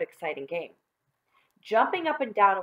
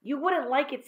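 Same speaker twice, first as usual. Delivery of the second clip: put-on aggrieved boy; 8 semitones higher, 3 dB louder than usual. Sorry-for-himself John complains.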